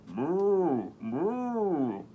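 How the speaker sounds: a lightly voiced moo; cow moo. Voicing the cow for the kids, light moo. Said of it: "said the cow".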